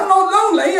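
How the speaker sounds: very high comic wail of protest; mock crying. Old man denying he is lonely, mock crying.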